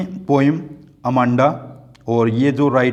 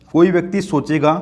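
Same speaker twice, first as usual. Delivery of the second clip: usual speaking voice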